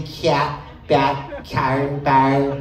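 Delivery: flat Wisconsin vowels in cat, bat, car, bar; Wisconsin accent. Shown as a demonstration. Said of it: "it starts to flatten out".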